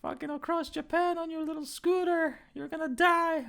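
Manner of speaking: high mock voice of an amazed fan; imitating fans. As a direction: pitch way up like a teasing fan club. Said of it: "It's like".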